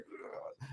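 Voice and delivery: short unvoiced grunt of effort; imitated grunt. Mimicking the doctor's jab to his cohost, breathy grunt. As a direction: grunt like shoving hard. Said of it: "he's just like".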